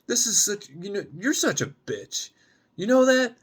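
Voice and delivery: furious rant at the rude date; angry outburst. Acted. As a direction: tell him off to his face. Gemma berates Alan at door.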